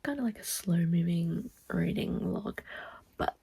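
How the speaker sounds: hushed late-night whisper. Real, not acted. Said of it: "I'm whispering 'cause people are in bed".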